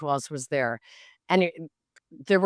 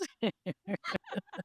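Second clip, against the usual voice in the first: real laugh; 4 semitones higher, 10 dB quieter than usual. Real, not acted. Laughing at the story.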